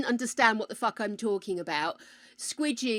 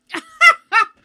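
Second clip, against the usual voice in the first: sudden high, loud laugh; high burst of laughter; 20 dB louder than usual. Real, not acted. Streamer reacts to the icky flavour in her mouth, loud high laugh. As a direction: laugh out at how grim it tastes.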